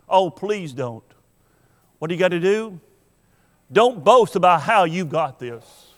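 ordinary delivery

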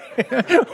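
laugh. Real, not acted. Laughs at own joke.

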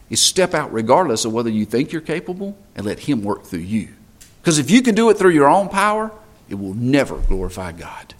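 usual speaking voice

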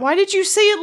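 loud, high outburst. Real, not acted. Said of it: "I'm sorry, that was loud".

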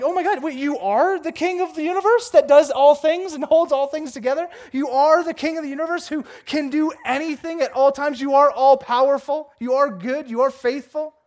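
loud, high-pitched incredulous amazement; astonished realization of who God is, building on each phrase; hammed-up shock at answered prayer; surprised. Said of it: "I'm, like, surprised".

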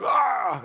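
high, loud cry of stress; stressed-out groan. Retold or imitated. Pastor recalling stress over conference money, stressed cry. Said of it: "I was stressing about it".

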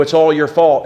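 normal talking voice

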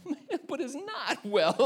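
high, slow, laughing wail; mock whimpering protest. Preacher objecting to singing It Is Well, mock whimper. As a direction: protest tearfully, half laughing.

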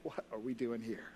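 low, soft disbelief; hushed wonder. Retold or imitated. The saved shake their heads in heaven, hushed wonder. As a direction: let it drop almost to nothing. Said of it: "just gonna shake our heads and say".

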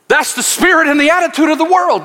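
normal talking voice

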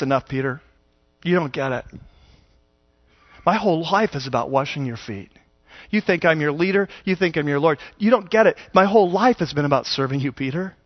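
low, soft, calm rebuke; firm tender Jesus. Retold or imitated. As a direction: settle him down gently, low and soft. Jesus answering Peter gently.

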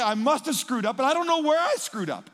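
loud, high mock quote; mocking friends' words. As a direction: throw their words back at them. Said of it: "All you guys do is say".

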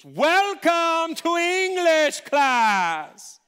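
high, drawn-out shout; grand teacher's welcome. Retold or imitated. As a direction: boom it out very slowly, arms spread wide. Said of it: "She spreads her arms out".

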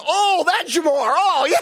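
high, loud mock sudden recollection; hammed-up "now I remember", laughing; imitating a caught fibber's exaggerated realization; caught-out coach. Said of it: "And he says".

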